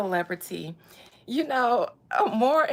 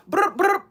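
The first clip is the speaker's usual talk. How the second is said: rapper ad-lib; 10 semitones higher, 8 dB louder than usual. Pretend.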